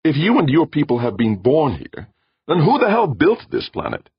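loud, high exasperated demand; heated cross-questioning, climbing in pitch. A narrator's reading. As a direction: build to an indignant challenge. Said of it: "Brandon demanded".

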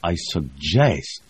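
slow, ironic stress on suggest; ironic emphasis. A narrator's reading. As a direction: lean on suggest with irony, drawn out. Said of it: "put an ironic emphasis on the verb".

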